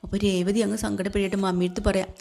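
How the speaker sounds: normal talking voice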